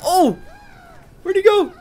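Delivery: very high, baffled squeak; high-pitched disbelief; astonished squeal. Real, not acted. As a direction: squeak in amazement at the vanishing target. Enemy flung out of sight.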